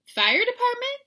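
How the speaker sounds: very high, loud question; puzzled echo. A narrator's reading. Little sister questions mama's plan, high and incredulous. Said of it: "repeated Jillyboo".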